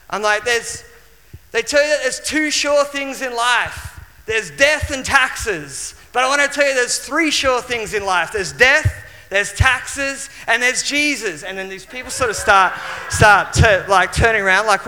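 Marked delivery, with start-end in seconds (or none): 0.5-11.4 high, raised open-air preaching; street preaching. Retold or imitated. Young man preaching to strangers at the lookout, raised preaching voice. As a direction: proclaim it to the whole hilltop. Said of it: "shouted as, as loud as I could".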